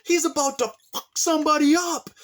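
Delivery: very high, startled cry; alarmed brother. Retold or imitated. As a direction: mimic the brother's sudden panic. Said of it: "He's like".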